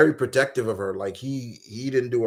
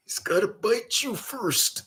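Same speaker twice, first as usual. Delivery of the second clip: imitating Macho Man; 10 semitones higher, 4 dB quieter, slower than usual. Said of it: "and then saying".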